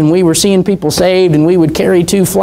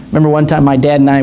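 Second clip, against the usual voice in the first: ordinary talk, nothing marked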